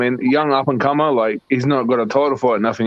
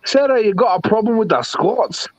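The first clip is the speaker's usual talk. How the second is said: higher-pitched, badly faked Scottish accent; mock Scottish accent; 7 semitones higher than usual. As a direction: challenge them in a hammy Scottish accent. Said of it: "that's a terrible Scottish accent".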